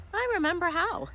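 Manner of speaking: put-on high, small spider boy voice; little spider voice. A narrator's reading. Sammy to his mother, high little spider voice. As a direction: say it proudly like a tiny spider.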